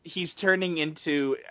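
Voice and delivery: ordinary delivery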